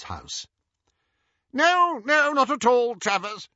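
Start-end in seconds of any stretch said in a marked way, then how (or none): 1.5-3.5 high, hurried reassurance; hasty cover-up. A narrator's reading. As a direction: rush to smooth it over. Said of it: "said Hermione quickly".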